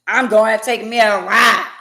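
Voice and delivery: loud mock huffy exit; big mad. Imitating a mad spouse announcing a drive, mock huffy. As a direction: imitate a sulking partner leaving. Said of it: "When somebody get big mad and say".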